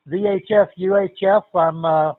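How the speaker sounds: usual speaking voice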